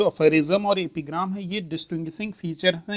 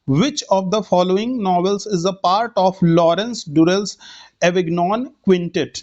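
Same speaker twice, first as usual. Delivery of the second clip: slow dictation read; much slower than usual. Shown as a demonstration. Teacher reads question slowly.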